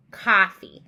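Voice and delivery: "Coffee" with a broad open ah; punched Boston vowel in "Coffee". Shown as a demonstration. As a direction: say "Coffee" loudly as cah-fee. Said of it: "in the Boston language".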